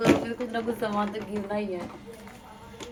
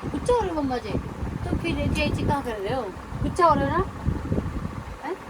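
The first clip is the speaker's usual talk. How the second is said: playful coaxing; 4 semitones higher than usual. Real, not acted. Mother coaxing sulking baby.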